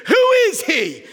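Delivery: high, loud royal demand; a furious king demanding the culprit's name; angry king. Acted. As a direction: demand it in a king's fury. King demands who threatened Esther.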